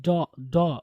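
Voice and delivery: "डॉ" with a rounded aw, "daw"; correct first syllable. Shown as a demonstration. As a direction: drill "डॉ" as a separate DAW. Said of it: "डॉटर नहीं". ("डॉ" کو گول آواز میں "ڈَو" کہنا)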